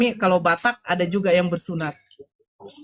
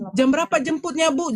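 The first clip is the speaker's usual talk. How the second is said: eager question; 7 semitones higher than usual. Retold or imitated. Narrator asks about the pickup.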